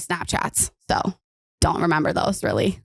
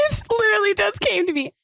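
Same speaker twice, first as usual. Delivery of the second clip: laughing; 14 semitones higher than usual. Real, not acted. Laughing at her dream.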